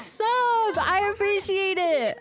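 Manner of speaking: gushing thanks. Real, not acted. Streamer thanks a viewer for the sub, high gushing voice. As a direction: draw out every word up high.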